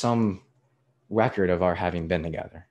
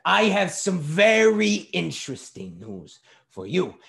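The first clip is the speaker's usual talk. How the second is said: higher, slow, deliberate announcement; pointed retort; 8 semitones higher, 4 dB louder than usual. A narrator's reading. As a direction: announce it pompously to the waiter. Father scornfully answering waiter.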